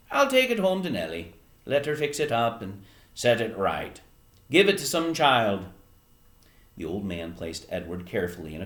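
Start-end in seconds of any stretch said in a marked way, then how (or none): 0.1-5.7 put-on louder, raised old sailor voice; decide it aloud as an old fisherman; folksy old man making plans; old fisherman, deciding. Old fisherman decides to keep Edward.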